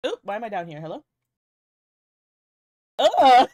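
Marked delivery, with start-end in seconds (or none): 3.0-3.5 loud disgusted groan. Real, not acted. Disgust at model look.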